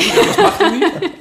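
high, slow laughing squeal; laughing plea. Real, not acted. Embarrassed joking plea.

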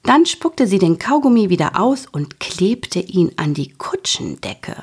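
usual speaking voice

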